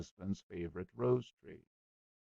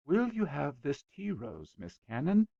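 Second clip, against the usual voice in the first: lightly voiced nervous suitor, higher; bashful young man; 7 semitones higher than usual. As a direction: ask shyly, hoping to please. Shy Robert.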